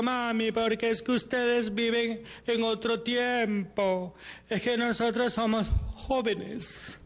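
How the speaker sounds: más agudo y lento, de joven displicente; hijo joven desdeñoso. Retold or imitated. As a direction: responde como adolescente que se cree superior. Imita al hijo joven respondiendo. (imitando al hijo que dice a la mamá que está pasada de moda)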